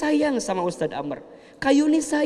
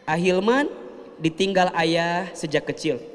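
ordinary talk

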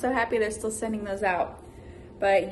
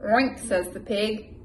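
lively, loud picture-book read-aloud; animated storybook voice for a little one; reading to a child; 3 semitones higher, 6 dB louder, slower than usual. Acted. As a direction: read it big and bouncy for a toddler.

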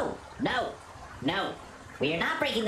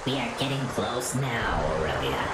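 deep guide voice; 10 semitones lower than usual. Acted. Low guide character voice.

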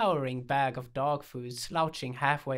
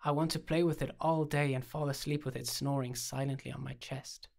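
plain reading voice

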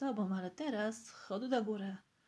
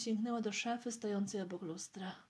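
plain reading voice

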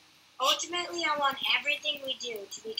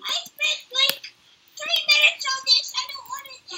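squeaky whiny plea; high-pitched voice; 12 semitones higher, 6 dB louder than usual. Acted. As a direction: whine it way up high. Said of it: "Why does your voice sound high-pitched".

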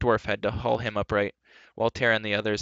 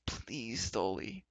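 pleading softly; 8 dB quieter, much slower than usual. Acted. Gwistle pleading.

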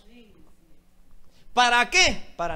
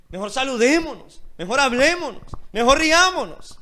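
building appeal; 3 semitones higher, 7 dB louder than usual. Acted. Pleading friendship, building louder.

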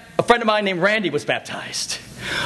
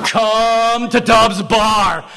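slow, drawn-out droning call; hammed-up sing-song invitation; mocking mimicry; much slower than usual. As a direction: parody the drawl right back at him. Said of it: "He just exaggerates it".

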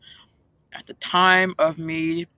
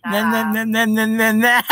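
teasing nah-nah; 2 semitones higher, 4 dB louder than usual. Real, not acted. Teasing the scammer.

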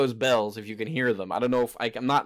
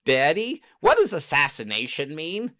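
put-on high, louder childlike puppet voice; puppet child voice; 7 semitones higher, 6 dB louder than usual. Puppet child asks Daddy about assassination, in a high kid voice. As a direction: play a curious little kid puppet asking Daddy. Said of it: "having little puppets saying".

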